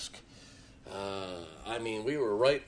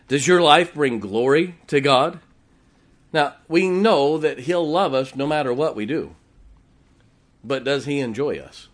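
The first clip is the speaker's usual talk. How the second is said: loud, high preaching questions; fiery sermon delivery; 4 semitones higher, 9 dB louder than usual. Acted. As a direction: press the question on the congregation. Preaching at full force.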